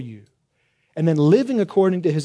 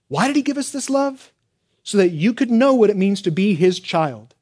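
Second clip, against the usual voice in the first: higher, insistent question and answer; emphatic quote; 5 semitones higher, 3 dB louder than usual. Retold or imitated. Preacher quoting John on being God's child, emphatic. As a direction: read the verse with great emphasis. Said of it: "and it's so emphatic".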